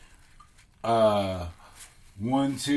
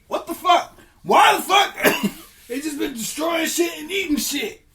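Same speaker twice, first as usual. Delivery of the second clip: very high, loud, incredulous rant; outraged ranting; 13 semitones higher, 5 dB louder than usual. Acted. Gods ranting about destructive humans, shrieking outrage. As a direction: screech your disbelief.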